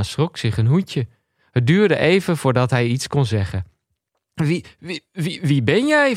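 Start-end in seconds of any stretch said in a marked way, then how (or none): 4.3-6.2 startled stammer. Acted.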